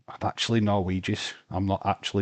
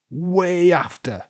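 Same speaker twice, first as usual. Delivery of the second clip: Way after said slow, high and louder, leaning on Way; emphatic; 8 semitones higher, 5 dB louder, slower than usual. Real, not acted. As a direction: drive the point home, stretching Way. Insisting in heated argument.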